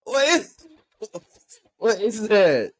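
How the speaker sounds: high, loud, stunned "what is that"; alarmed shock. Real, not acted. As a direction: recoil at something horrifying. Startled by creature in video.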